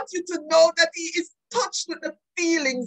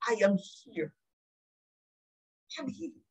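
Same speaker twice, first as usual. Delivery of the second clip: low, hushed voice of God; tender reassurance; 7 semitones lower, 8 dB quieter than usual. Acted. God assuring the suffering of being present, tender. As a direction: let it sink softly, with a long pause.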